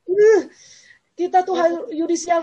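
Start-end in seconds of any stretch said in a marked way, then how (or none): 0.1-0.5 short, high, loud "uh"; mock yell. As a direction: punch out a brief high cry.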